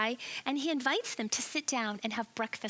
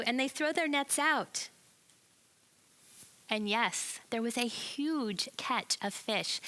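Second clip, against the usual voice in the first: ordinary talk, nothing marked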